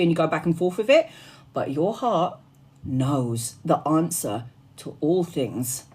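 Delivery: low, soft and intimate counsel; soft spiritual advice. Acted. Medium gently counsels her viewers to trust their heart. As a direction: hush down and speak from the heart.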